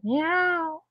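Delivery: high, slow drawn-out meow, lightly mocking; imitating cat's meow. Owner voicing her cat's demand with a slow high meow. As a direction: stretch the meow out like a demanding cat. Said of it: "it's like".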